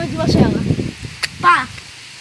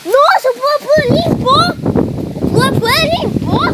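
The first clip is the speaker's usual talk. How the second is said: excited; 9 semitones higher, 5 dB louder than usual. Real, not acted. Excited at the clean trampoline.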